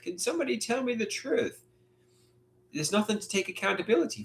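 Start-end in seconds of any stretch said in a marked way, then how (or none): 0.0-1.7 mild mock scream. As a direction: repeat the cry high, ending on a lift. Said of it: "I wanna scream".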